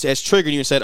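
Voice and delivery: ordinary talk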